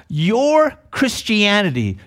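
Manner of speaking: ordinary talk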